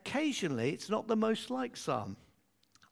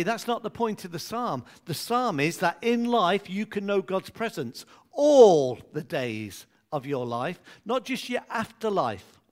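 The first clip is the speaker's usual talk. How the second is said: ordinary delivery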